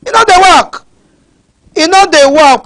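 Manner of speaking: loud, high-pitched scolding; angry outburst. Real, not acted. Angry outburst about idle youths.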